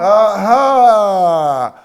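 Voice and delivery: loud, high, drawn-out aha; triumphant approval. Real, not acted. Teacher pleased pupil spoke up, loud approval. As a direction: stretch it out in delighted approval.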